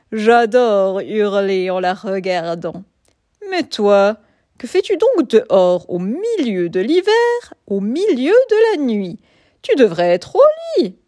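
put-on loud, booming old wolf; big wolf voice, a bit gruff. A narrator's reading. Old wolf speaks to cub.

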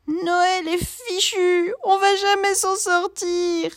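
high, slow tearful wail; crying despair. A narrator's reading. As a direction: sob that Christmas is ruined. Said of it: "crie Cléo".

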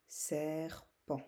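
"serpent" split into two beats; syllable by syllable. Shown as a demonstration. Teacher letting pupils locate the sound, syllable by syllable. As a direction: say "ser", pause, then "pent". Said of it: "la première syllabe ou dans la deuxième".

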